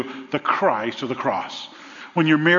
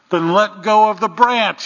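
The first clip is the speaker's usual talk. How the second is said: higher, much louder command from the sky; voice from above; 5 semitones higher, 6 dB louder than usual. Retold or imitated. The Lord tells the man to let go, booming. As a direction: boom it down like the Lord. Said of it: "he hears a voice from above".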